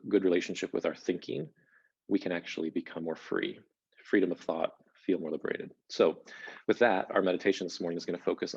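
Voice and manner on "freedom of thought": usual speaking voice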